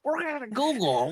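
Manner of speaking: high Vince Vaughn quote; Vince Vaughn impression. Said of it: "Is that a quote".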